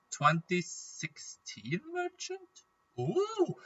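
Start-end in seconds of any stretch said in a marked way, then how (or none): 3.0-3.5 surprised. Real, not acted. Surprised at item price.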